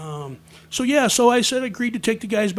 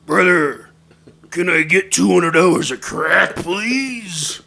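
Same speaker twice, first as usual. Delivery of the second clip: booming wrestler character voice; loud, theatrical impression of a wrestler's plea; 9 dB louder, slower than usual. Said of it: "J Y D says in his voice".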